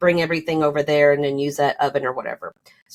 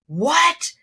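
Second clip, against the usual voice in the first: high, incredulous exclamation; mock-startled outburst; retold shock; 11 semitones higher than usual. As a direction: imitate a stunned reaction, pitched way up. Partner's shocked reaction, retold.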